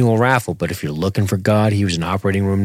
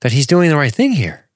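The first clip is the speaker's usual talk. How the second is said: sarcastic; 9 semitones higher than usual. Pretend. High sarcastic build.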